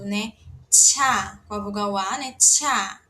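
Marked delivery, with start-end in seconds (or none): 0.7-1.4 "Tcha" with a strong puff, louder; aspirated TCH. Shown as a demonstration. Teacher demonstrating aspirated T C H with vowel A, puffed. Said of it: "vamos pronunciar aquele T C H aspirado". 2.3-3.0 "Tcha" with a strong puff, louder; aspirated TCH. Shown as a demonstration. Teacher demonstrating aspirated T C H with vowel A, puffed. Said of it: "vamos pronunciar aquele T C H aspirado".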